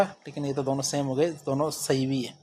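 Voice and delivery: ordinary delivery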